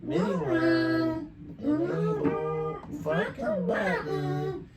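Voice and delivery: extremely high pitch-shifted chatter; super high voice. Shown as a demonstration. Streamer fiddling with buttons, super high voice effect. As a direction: talk through a tiny, squeaky pitch effect. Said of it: "it's super high voice".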